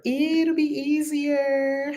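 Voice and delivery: high, slow, sing-song repeat; playful high drawn-out echo. Pretend.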